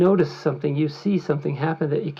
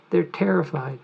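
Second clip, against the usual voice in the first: ordinary delivery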